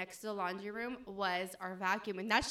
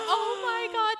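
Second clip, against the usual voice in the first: shocked exclamation; 13 semitones higher, 11 dB louder, slower than usual. Retold or imitated. Retelling seeing a ghost boy.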